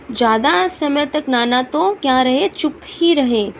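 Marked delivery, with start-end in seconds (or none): none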